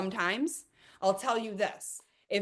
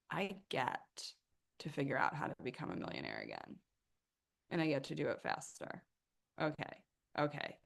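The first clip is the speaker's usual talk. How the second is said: quiet; 4 semitones lower, 8 dB quieter than usual. Retold or imitated. Whispered self-pep talk.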